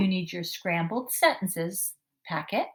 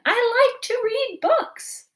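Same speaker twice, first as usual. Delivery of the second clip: high and loud, slow and thrilled; very excited; 6 dB louder, much slower than usual. Shown as a demonstration. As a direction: gush it like the best news ever. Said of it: "We're doing it very excited".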